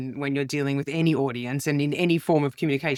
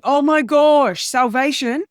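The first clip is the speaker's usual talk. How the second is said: much higher and louder, slow and drawn out; relieved delight; 8 semitones higher, 7 dB louder, slower than usual. Retold or imitated. Shunned comedian relieved a couple will sit with her, drawn-out delight. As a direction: melt with relief as if rescued.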